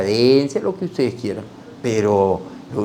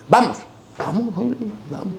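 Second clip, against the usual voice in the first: impatient urging; 11 semitones higher than usual. Retold or imitated. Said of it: "uncle urging him".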